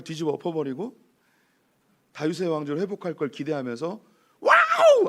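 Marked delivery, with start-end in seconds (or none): 4.4-5.1 high, loud burst of cheering; imitated crowd yell. Preacher imitating people expecting David's kingdom restored, yelling. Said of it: "하면서 막 소리 지르면서".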